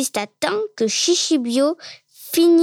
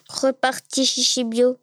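ordinary delivery, nothing marked

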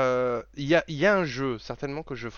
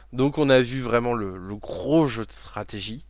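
ordinary talk

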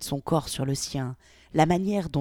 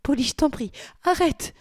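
much higher, panicked begging; near panic; 11 semitones higher, 4 dB louder than usual. Acted. Victoria begs Polly on the brink of panic. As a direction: beg on the edge of panic. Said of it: "au bord de la panique".

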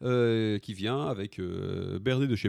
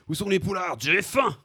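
high, loud mock yell; boorish bellow; 8 semitones higher, 8 dB louder than usual. Lout calling for poulardes in a restaurant, boorish bellow. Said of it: "tu gueules dans le resto".

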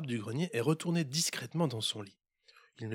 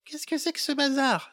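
much higher, loud angry mother; mother shouting; 13 semitones higher, 4 dB louder than usual. Acted. Mother shouting down about the noise, high and loud. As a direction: yell it from upstairs. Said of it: "s'écria à l'étage la maman".

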